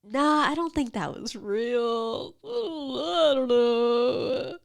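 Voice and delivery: slow, unsure waking mumble; groggy doubt. Pretend. Waking from a nightmare, unsure mumble. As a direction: talk yourself out of the dream. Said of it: "wake up and be like".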